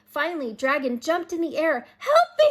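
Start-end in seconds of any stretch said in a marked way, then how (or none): 2.0-2.5 very high, loud scream for help; terrified scream. A narrator's reading. Dragon screaming for help in the dark woods. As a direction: shriek in pure panic. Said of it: "he screamed".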